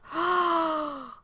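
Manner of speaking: high, hammed-up shocked gasp; dramatic gasp. Dramatic reaction to the betrayal.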